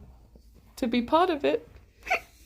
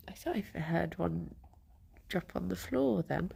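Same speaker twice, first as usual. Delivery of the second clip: whispering; 10 dB quieter than usual. Real, not acted. Whispering about dropped bunny.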